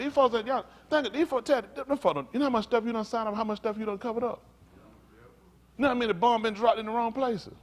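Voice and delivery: sustained high-pitched preaching challenge; impassioned preaching. Real, not acted. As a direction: fire accusing questions on a high, driving pitch. Preacher's rapid accusing questions.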